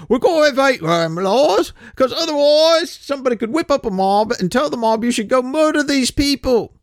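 high, loud put-on European accent; horrible foreign accent. Mocking European lawmakers in a horrible accent. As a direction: ham up a bad continental accent. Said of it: "The horrible accent".

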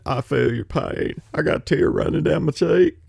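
a hint of a syrupy Clinton sympathy voice; Bill Clinton impression. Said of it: "I can do a Bill Clinton imitation".

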